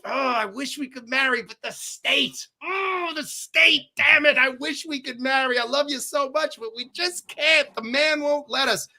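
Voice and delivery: high, hammed-up lovelorn wail; a mock melodramatic sigh over the state; mock lament. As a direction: play the tragic lover who can't marry, overdone. Friend mocks his old excuse.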